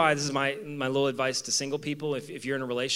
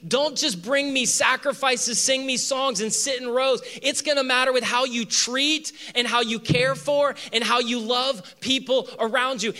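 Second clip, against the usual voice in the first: raised, louder Jesus pleading with the crowd; Jesus voice, higher and louder; voicing Jesus; 7 semitones higher, 4 dB louder than usual. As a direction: speak as Jesus, urgent and lifted. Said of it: "And Jesus is like".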